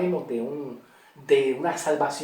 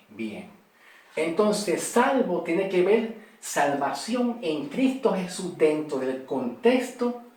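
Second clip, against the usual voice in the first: ordinary delivery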